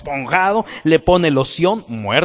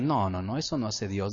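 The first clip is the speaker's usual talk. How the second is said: usual speaking voice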